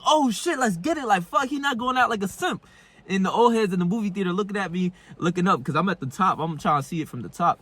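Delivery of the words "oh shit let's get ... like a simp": high-pitched excited moviegoer, retold; hyped-up reaction. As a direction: relive the hype in a much higher voice. Reenacting his hyped reaction in theater.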